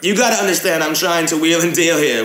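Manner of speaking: brisk haggler's fake English accent; put-on English accent. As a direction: wheel and deal in a mock English accent. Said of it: "gaming in an English accent".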